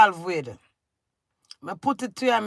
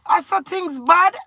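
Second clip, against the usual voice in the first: exasperated cry; 8 semitones higher, 7 dB louder than usual. Real, not acted. Angry rant at absent husband.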